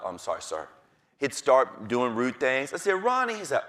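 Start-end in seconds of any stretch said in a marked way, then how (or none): 0.0-0.7 mumbled lower, softer apology; sheepish kid. Retold or imitated. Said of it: "He'd be like". 3.0-3.3 name called out high and sharp; teacher warning. Retold or imitated. Teacher warning a misbehaving kid.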